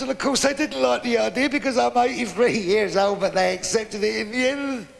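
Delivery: high-pitched, sustained mock weeping; crying old man. Old man pouring out his love story, comically tearful. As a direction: tell it all through hammed-up tears. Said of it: "crying his eyes out".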